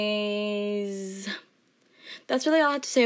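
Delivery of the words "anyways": long, stretched-out "Anyways"; drawn-out transition. Real, not acted. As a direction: drag the word out playfully. Drawn-out return to the topic.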